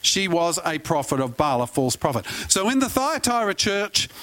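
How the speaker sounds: ordinary delivery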